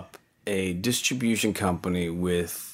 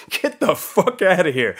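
higher, louder half-laughing get-out; mock boss throw-out; 11 semitones higher, 6 dB louder than usual. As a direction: shoo him out in mock fury. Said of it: "He goes".

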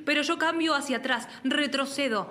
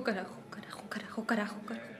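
whispered curse; 5 semitones lower, 9 dB quieter, faster than usual. Acted. Hushed cursing so the friend won't hear.

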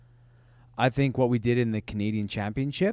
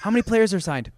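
caller asking, higher and louder; 7 semitones higher, 6 dB louder, faster than usual. Retold or imitated. Callers questioning ticket seller.

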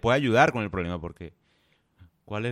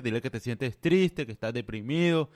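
normal talking voice

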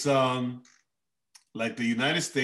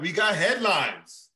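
catchphrase hype; 8 semitones higher, 4 dB louder, slower than usual. Pretend. Repeated show catchphrase.

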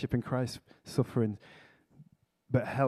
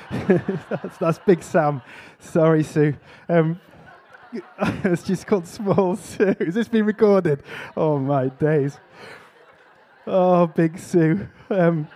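giggling through an embarrassed slip; high, laughing, slow words of apology; 4 semitones higher, 5 dB louder, slower than usual. Real, not acted. Preacher laughing at his own name mix-up before the congregation, high and giggly.

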